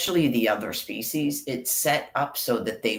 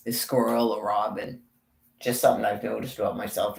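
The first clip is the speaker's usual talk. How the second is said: ordinary talk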